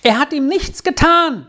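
very high-pitched, excited call; calling from above. A narrator's reading. Squirrel calls to her father from upstairs, excited. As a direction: call news out in a high child's voice. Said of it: "rief sie schon von oben".

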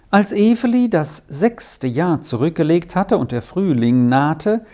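ordinary delivery, nothing marked

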